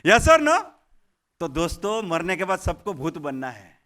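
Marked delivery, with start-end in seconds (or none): 0.1-0.6 loud audience question. Real, not acted. Trainer asks audience loudly.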